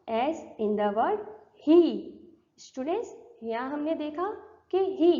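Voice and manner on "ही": ही with a lightly stressed long E; slight vowel stress. Shown as a demonstration. Teacher showing students the long E, stressed vowel in ही. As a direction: put a little weight on the long ई in ही. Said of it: "ई पर थोड़ा stress दिया है".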